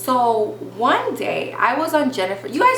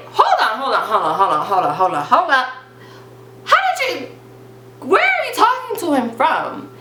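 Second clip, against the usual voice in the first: shocked disbelief; 3 semitones higher than usual. Retold or imitated. To her laughing best friend, a high shocked hold on. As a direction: stop her mid-sentence in disbelief.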